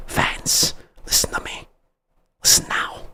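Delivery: sudden hushed whisper. Acted. Host hushes Seahawks fans in a conspiratorial whisper. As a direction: lean in and breathe it like a secret. Drops to whisper mid-rally.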